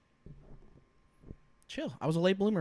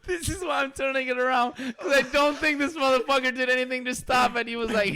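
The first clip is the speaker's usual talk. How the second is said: usual speaking voice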